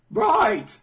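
high, loud bark; mock shout. Troops answering, high and loud mock call. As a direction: snap it out like a soldier. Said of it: "the voice is like".

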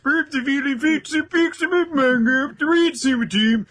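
high, sing-song mock Swedish Chef babble. Reading the Swedish fan's email as the Swedish Chef, sing-song and high. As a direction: ham up the Muppet chef reading the fan email. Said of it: "my terrible Swedish Chef voice".

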